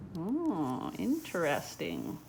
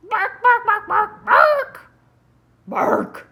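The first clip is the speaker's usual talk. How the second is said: very high, loud puppy barks; frantic barking; 17 dB louder, much slower than usual. Acted. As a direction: bark over and over like a puppy that won't settle.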